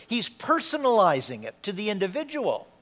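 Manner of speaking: ordinary talk, nothing marked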